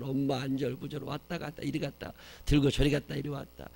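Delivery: ordinary delivery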